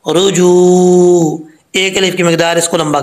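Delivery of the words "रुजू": रुजू with a long drawn-out u. Shown as a demonstration. Teacher showing the madd to students, drawn out. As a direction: stretch रुजू. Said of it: "वाव मद्दा होता है".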